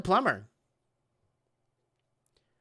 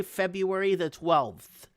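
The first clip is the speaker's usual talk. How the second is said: usual speaking voice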